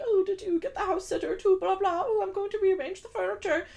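high-pitched rich-person chatter voice; rich people. Retold or imitated. Podcaster voicing rich people on the shuttle, high mocking voice. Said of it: "like, rich people".

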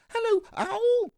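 very high squeaky little creature; excited squeak. A narrator's reading. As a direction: squeak it out like a tiny excited child. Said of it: "So he said".

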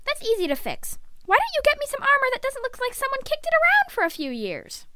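put-on high, put-upon teenage whine; whining retort. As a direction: argue back at mom in a high voice. Son argues back at mother.

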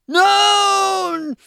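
high, loud, long-held mock wail; anguished, angry shout. Bad guy sees his brother's head caved in, mock anguished shout. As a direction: wail it out as a hammed-up movie cry. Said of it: "he's getting really angry".

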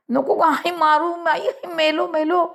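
put-on high-pitched child's wail under a beating; acted panicked cry for mercy; crying out pleading. A narrator's reading. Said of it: "मी ओरडू लागलो".

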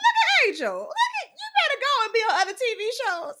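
high, loud, laughing squeal of recognition; excited outburst. Retold or imitated. Retelling how she yelled at the TV on seeing Angela, a high excited squeal. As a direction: gush at the TV like spotting a friend.

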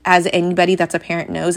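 normal talking voice

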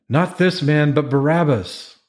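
mild high-pitched outcry; crying out. A narrator's reading. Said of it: "they cried out again".

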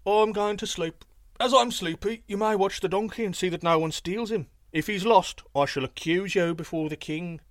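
voiced thief warning the guards; thief speaking to sentinels. A narrator's reading. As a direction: thief pretends sleepiness and warns the sentinels.

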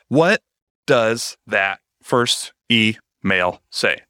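drawn-out, dramatic question; dramatic slow question. Shown as a demonstration. Host mocking her own dramatic slow delivery. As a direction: stretch the words out slowly for drama. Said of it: "I kinda hate it when I talk like that".

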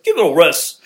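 lightly put-on British accent; playful attempt at a British accent. Shown as a demonstration. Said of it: "like, a British accent to myself".

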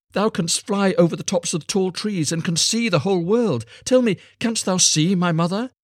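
put-on high, loud child's appeal; star child, urgent plea. A narrator's reading. Star child questioning the linnet.

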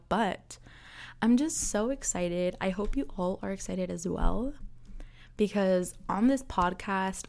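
ordinary delivery

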